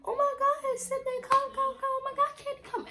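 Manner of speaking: frantic gossip summons in the bathroom; excited calling out. Retold or imitated. Pink calls the girls over, squealing excitement. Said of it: "Pink was like".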